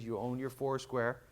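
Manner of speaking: ordinary talk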